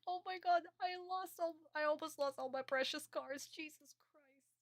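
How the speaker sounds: high, squeaky fretting; hammed-up high-pitched distress; mock panic. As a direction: wail over a near loss in a high mock voice. Acts out Jonouchi nearly losing cards.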